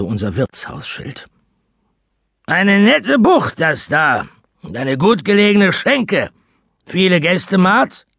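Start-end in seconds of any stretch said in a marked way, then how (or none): 2.5-8.1 high, loud, coarse sailor voice; rough old seaman. Acted. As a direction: size up the tavern like a rough sea dog. Seaman speaks to the innkeeper.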